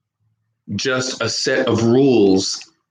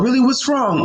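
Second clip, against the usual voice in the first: high, eager concern; high caring buddy; concerned American friend; 14 semitones higher than usual. Retold or imitated. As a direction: lean in with quick sympathy. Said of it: "how we talk in America".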